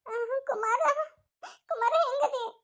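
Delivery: high, tearful calling; high-pitched anguished question; distraught concern. Acted. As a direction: call anxiously to an injured loved one. Distraught relative calls injured man.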